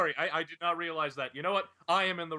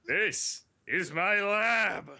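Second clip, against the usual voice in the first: slow, drawn-out Plankton villain voice; lightly voiced Plankton gloating over his lab; Plankton impression; 2 semitones higher, much slower than usual.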